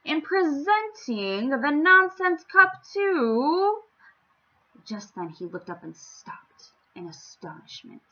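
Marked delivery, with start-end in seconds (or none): 0.0-3.8 slow, high, stretched proclamation; royal announcement, breaking off. Acted. King about to name the winner, slow and high. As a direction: build the suspense of the name. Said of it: "announced the king".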